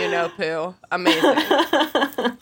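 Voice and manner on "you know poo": deadpan, slightly lowered imitation; dignified narrator deadpanning a potty joke; Jim Dale. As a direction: say it dead straight, pitched a little low. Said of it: "Having to hear him deadpan".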